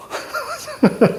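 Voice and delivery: short high burst of laughter. Real, not acted. Teacher laughing at his own name joke, high.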